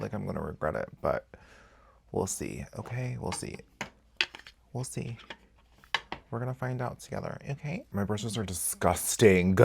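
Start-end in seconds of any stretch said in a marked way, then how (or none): 2.1-7.8 softer, muttered doubt. Real, not acted. Unsure about the curved case.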